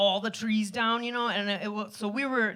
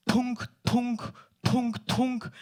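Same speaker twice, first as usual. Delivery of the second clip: thudding noise; slower than usual. Retold or imitated. Imitating the canoe hitting rocks.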